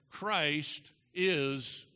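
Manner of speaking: slow, word-by-word declaration; spaced out so all can join in; leading a congregation. Shown as a demonstration. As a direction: lead them through it, very slowly. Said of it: "say it with me this morning together".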